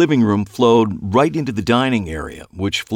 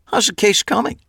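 casual; 14 semitones higher than usual. A narrator's reading. Changing the subject.

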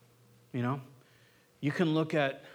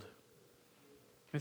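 whispered aside; 15 dB quieter than usual. Real, not acted. Aside mid-sermon.

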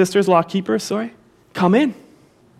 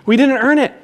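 "We didn't earn it" said louder and higher, rising on "earn"; louder call for the congregation; 5 semitones higher, 3 dB louder than usual. Shown as a demonstration. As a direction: lead the room, lifting the voice. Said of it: "Say it with me".

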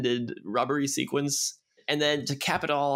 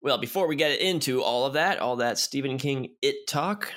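usual speaking voice

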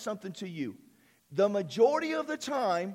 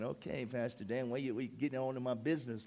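mock annoyed congregant; 7 semitones lower, 7 dB quieter than usual. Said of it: "people kinda looking at me like going".

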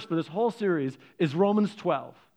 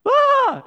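high loud gasping cry; startled high "Ah!"; mock shriek; 9 dB louder than usual. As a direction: ducks recoiling in mock horror. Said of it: "all the ducks are like".

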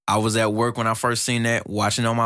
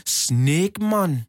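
higher, mock Jamaican accent; 5 semitones higher than usual. Host mimics Luffy announcing his attack, Jamaican accent. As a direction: put on a playful Jamaican accent. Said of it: "like, this Jamaican accent".

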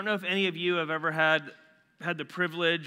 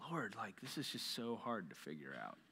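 hushed confession; 5 semitones lower, 14 dB quieter than usual. Real, not acted. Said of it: "sharing his own struggle softly".

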